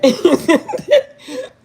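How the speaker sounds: squealing burst of laughter; loud high laugh. Real, not acted. Laughing mid story.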